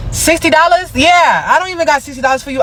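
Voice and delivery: high-pitched, incredulous scoff; mock incredulity. Would-be buyer refusing Tyla's price, high mock incredulity. Acts outrage at being asked sixty dollars.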